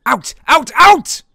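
high, loud angry yell. A narrator's reading. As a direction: chase him out of the box. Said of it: "yelled the signalman".

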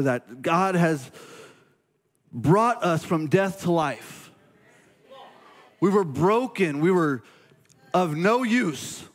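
slow, rising sermon emphasis; preaching. Acted. Pastor pressing scripture on his congregation, slow preaching build.

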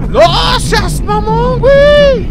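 very high, drawn-out scream; screamed protest. Real, not acted. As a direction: wail it at full volume in disbelief. Screamed repeat at the game's twist. (grito agudo y alargado de protesta)

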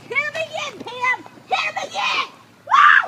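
shrill cheering on a fight; high-pitched cheering. Real, not acted. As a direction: cheer her on at full pitch.

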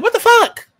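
high shocked What the fuck; shocked outburst. Retold or imitated. Reacting to Lulu shooting him, high shocked squeal. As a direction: squeal it in disbelief. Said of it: "I'm like".

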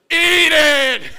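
very loud, high chorus yell; whole family ordering the uncle to eat; family shout. Retold or imitated. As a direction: everyone yells it together. Whole family chanting like choir.

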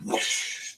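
unvoiced rushing whoosh; imitated whoosh. Shown as a demonstration. Game master showing a drink arcing overhead, whooshing. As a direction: make the drink's whoosh with breath. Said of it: "goes like this".

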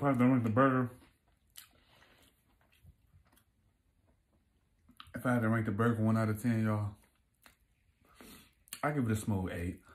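speaking while chewing food; words muffled by food in mouth; mouth full. Real, not acted. Seen 2: Burger in hand, jaw working and lips pursed while he speaks.

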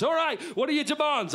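high, projected call; hostage negotiator. Acted. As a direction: call across to the hostage-taker. Negotiator calls to hostage-taker.

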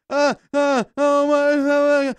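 high, loud panicked stammer; hammed-up fear, stuttering; mock panic stammer. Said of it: "And you're just like".

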